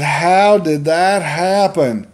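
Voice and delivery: drawn-out, high mock shock; incredulous outburst. Son shocked by mother's news.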